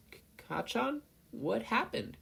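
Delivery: put-on a boy just waking up; groggily. A narrator's reading. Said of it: "he said groggily".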